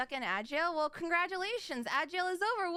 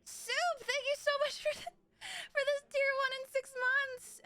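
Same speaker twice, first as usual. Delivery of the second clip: mock crying; 12 semitones higher than usual. Mock sobbing thanks for sub.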